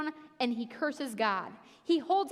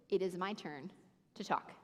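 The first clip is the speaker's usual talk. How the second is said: God's quiet voice; 6 semitones lower, 7 dB quieter than usual. Retold or imitated. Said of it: "God goes".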